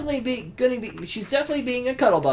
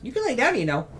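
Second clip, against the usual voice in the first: higher, soft cooing pet talk; 4 semitones higher, 7 dB quieter than usual. Real, not acted. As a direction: coo gently at the cat. Talking to the cat.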